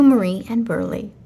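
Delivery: ordinary delivery, nothing marked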